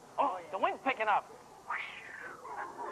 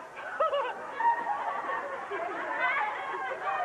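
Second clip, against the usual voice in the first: villain laugh; 12 semitones higher than usual. Acted.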